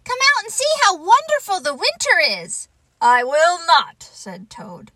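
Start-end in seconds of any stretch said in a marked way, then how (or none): 0.0-2.7 high, loud, rapid invitation; excited cry. Acted. Frog urging Toad out into winter, excited cry. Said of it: "he cried". 3.0-4.0 loud, higher, emphatic refusal; stubborn refusal. Acted. Toad in bed refusing Frog's invitation, stubborn.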